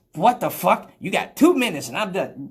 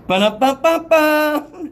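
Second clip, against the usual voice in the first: slow silly scat sound; 8 semitones higher, 5 dB louder, slower than usual. Retold or imitated. Imitating the act.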